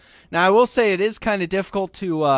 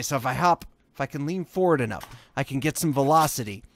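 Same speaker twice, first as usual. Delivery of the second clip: usual speaking voice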